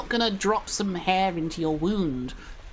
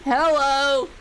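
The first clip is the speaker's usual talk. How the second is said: excited hello; 6 semitones higher, 6 dB louder than usual. Real, not acted. Greeting a newly arrived viewer.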